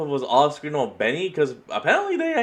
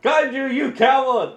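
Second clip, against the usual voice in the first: laughing; 5 semitones higher than usual. Real, not acted. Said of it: "listener laughing accusation".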